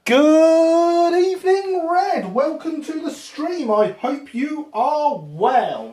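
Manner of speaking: high, slow butler-like welcome; a pompous host welcoming a viewer by name; grand formal greeting. Pretend. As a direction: roll out a stately, drawn-out hello. Greets chat member formally.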